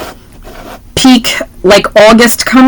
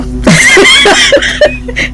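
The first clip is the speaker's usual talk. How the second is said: high laugh. Real, not acted. Laughing at co-host's sign-off.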